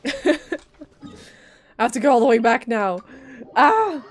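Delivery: loud, frustrated groaning complaint; exasperated outburst. Real, not acted. Streamer facing a long trek back, loud exasperated complaint. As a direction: let the annoyance burst out.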